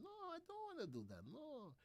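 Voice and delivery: soft falsetto Spanish Jamaican accent; tiny, high mock Spanish Jamaican voice. Shown as a demonstration. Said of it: "That is my Spanish Jamaican accent".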